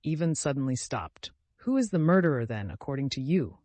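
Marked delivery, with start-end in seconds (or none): none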